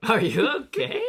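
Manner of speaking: loud, high, laughing mimicry; mock concerned mom. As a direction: play the worried mom for laughs.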